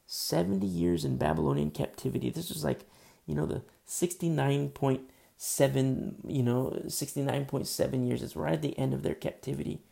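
ordinary talk, nothing marked

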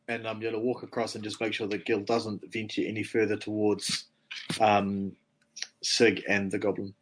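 ordinary delivery

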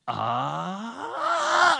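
rising high, loud scream; imitated scream. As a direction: mimic her scream, climbing in pitch and volume. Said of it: "she starts screaming".

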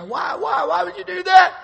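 high, loud mock terrified scream; retold screaming cry. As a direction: shriek it like a frightened wife. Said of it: "she began to scream and cry".

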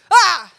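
sudden loud, high "Ah!"; short burst of a shout; mock scare yell. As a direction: jump out and startle someone. Dad jumping out to scare.

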